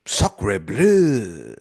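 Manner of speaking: lightly put-on French accent; French exclamation. Dying minister's last words, a hint of French accent. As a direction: sigh it out like a stock Frenchman. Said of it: "the last thing you hear him say is".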